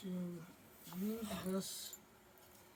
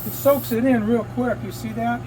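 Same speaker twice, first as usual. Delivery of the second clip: louder, raised voice over the fan noise; talking over fan; 3 semitones higher, 9 dB louder than usual. Real, not acted. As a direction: project to be heard above the booth fan. Talking over paint booth fan.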